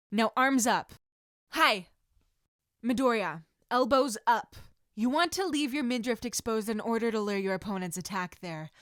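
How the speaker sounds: loud instructor calling out corrections; shouted drill commands. Acted. Sensei drilling students mid-workout, loud commands. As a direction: bark it across the mat.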